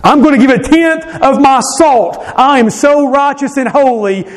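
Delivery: high, prim self-righteous boast; puffed-up Pharisee bragging, pitched high; mock Pharisee. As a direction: play the smug holy man. Mocking voice of Pharisees.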